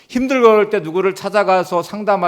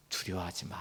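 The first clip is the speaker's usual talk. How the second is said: much softer and lower; God calming the fearful, soft and low; soft reassurance; 9 semitones lower, 19 dB quieter than usual. Retold or imitated. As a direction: murmur it to calm fear. God's comforting words voiced.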